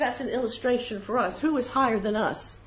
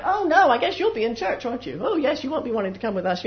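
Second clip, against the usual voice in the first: high, loud, exaggerated mock dismay; hammed-up sarcastic jeer; mocking taunt; 5 semitones higher, 5 dB louder than usual. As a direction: make fun of a churchgoing friend. They start making fun of you.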